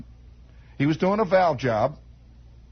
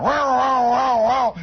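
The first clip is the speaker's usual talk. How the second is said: imitated engine roar; 6 semitones higher, 5 dB louder, slower than usual. Imitating radial engines winding up.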